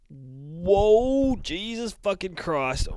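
high, loud, drawn-out whoa; thrilled, stretched-out reaction; amazed outburst. Real, not acted. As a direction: let a long whoa swell into a stunned exclamation. Host reacting to the cliffhanger ending.

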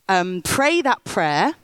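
ordinary talk, nothing marked

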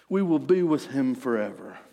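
ordinary talk

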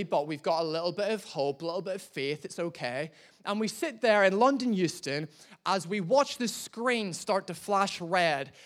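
usual speaking voice

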